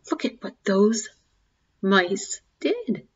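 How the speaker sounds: plain reading voice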